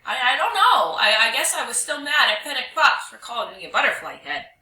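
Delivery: loud, high, stammering confession; quivering voice. Acted. Dog confessing to the spirit, voice quivering. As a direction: blurt it out fast and frightened. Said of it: "Dog's voice quivered".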